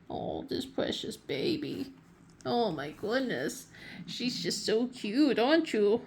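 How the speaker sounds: soft, slow cooing over a cat; tender cooing. Real, not acted. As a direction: coo softly over a precious pet. Cooing over her purring cat.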